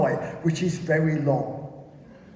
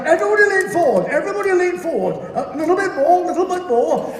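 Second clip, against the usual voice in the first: high, fast, loud urging; rattled officer, pitched right up; panicked pilot; 11 semitones higher, 5 dB louder, faster than usual. Acted. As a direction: urge them on in strained high voice. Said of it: "He's like".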